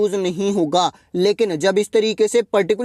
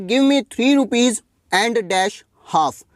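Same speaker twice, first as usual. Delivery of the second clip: very slow, word by word; slow read-out; much slower than usual. Shown as a demonstration. Teacher reading exercise sentence to class, very slow. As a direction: read the question out slowly for students to follow.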